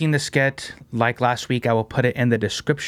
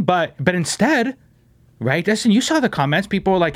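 ordinary talk

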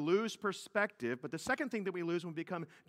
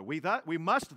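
normal talking voice

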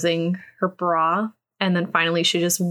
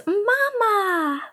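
Mama. said much higher, cutesy, slowly falling off; 13 semitones higher than usual. Retold or imitated. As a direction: coo Mama. up high like a shy little child. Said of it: "it was a lot cuter".

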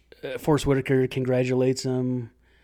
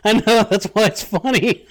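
higher and louder, talking through laughter; laughing; 7 semitones higher, 4 dB louder than usual. Real, not acted. Host answering his co-host while still laughing. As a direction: laugh as you defend the joke.

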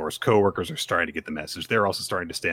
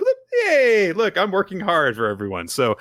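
high, loud cheery cheerleading; pep them on with beaming zeal; chirpy Yor; 11 semitones higher, 3 dB louder than usual. Retold or imitated. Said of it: "And she stacks up".

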